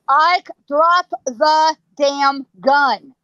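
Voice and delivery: very slow, weighty order, imitated; booming command. Wyatt ordering Ike again, slow and booming. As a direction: boom it word by word. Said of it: "booms it out at him".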